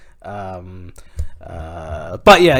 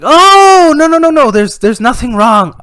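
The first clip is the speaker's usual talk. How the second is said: long high "oh", then easing down; exaggerated reassurance; dad calming panic; 14 semitones higher, 8 dB louder, slower than usual. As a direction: hurriedly wave the worry away, high and loud. Dad soothing his panicking daughter.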